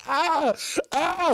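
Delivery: high strangled yelp; weird scream. Retold or imitated. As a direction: mimic a weird pained scream. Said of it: "the weirdest fucking scream I ever heard".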